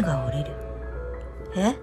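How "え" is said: ordinary delivery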